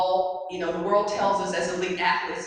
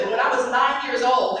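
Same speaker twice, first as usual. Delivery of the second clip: ordinary talk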